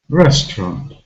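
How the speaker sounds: "Restaurant" stressed on its first syllable. Shown as a demonstration. Teacher asking learners which syllable is stressed, "Restaurant" stressed at the start. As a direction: lean on the start of "Restaurant", second syllable weak. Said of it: "the first syllable is stressed".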